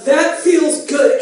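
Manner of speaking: slow and loud, spelled out; loud slow repeat. Acted. Repeated louder for deaf partner.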